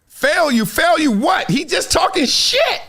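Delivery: high-pitched exasperated disbelief; real scornful disbelief, high voice; incredulous scoffing. Real, not acted. Scoffing at the fan's complaint.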